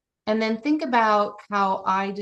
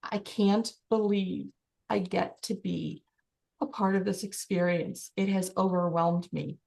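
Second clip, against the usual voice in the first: hushed, lower voice, overcome by the moment; overwhelmed awe; 3 semitones lower, 5 dB quieter than usual. Shown as a demonstration. Coach voicing a winner taking it in, overwhelmed. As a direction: let it sink in, softly. Acted overwhelm at imagined championship win.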